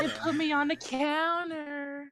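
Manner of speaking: slow, laughing mock-seductive line; hammed-up slow-jam lyric, fighting laughter; mock sultry singer.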